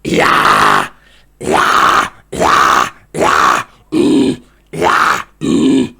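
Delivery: low, loud guttural growl on yeah; deep growl. Shown as a demonstration. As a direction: growl from the gut like death metal.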